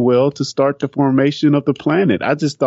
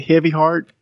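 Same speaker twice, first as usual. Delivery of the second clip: usual speaking voice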